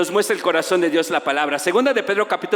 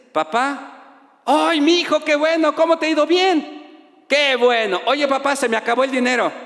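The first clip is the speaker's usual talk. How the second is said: put-on higher, chirpy young son's voice; son on the phone; 10 semitones higher than usual. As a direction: put on a sweet kid asking for money.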